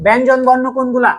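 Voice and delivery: usual speaking voice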